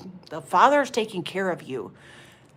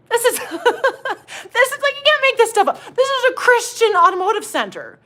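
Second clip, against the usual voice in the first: cracking up, high-pitched; high, incredulous laughing delivery; 12 semitones higher, 3 dB louder than usual. Real, not acted. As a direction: barely get it out through real laughter. Cracking up retelling the mechanics' answer.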